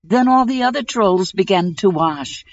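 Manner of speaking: plain reading voice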